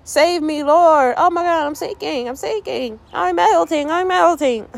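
high, whiny hammed-up plea for help; mock panicked cry. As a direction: wail comically as if going under. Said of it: "he shouted".

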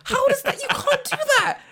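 high, loud, laughing disbelief; a kid yelling at the TV, half laughing; childhood outrage. Retold or imitated. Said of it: "I remember as a kid watching this being like".

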